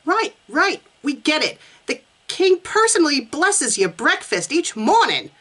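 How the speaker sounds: a high, hammed-up Boston accent; atrocious attempt at a Boston accent; bad Boston accent. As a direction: try a Boston accent and miss. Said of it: "Maybe I should do the Boston accent".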